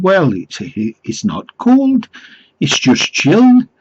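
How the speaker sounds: high, hammed-up Geordie; mock Geordie accent. Caller argues with the no cold callers sign, mock Geordie. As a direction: quibble in a put-on Geordie accent. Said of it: "I don't think it was a genuine accent".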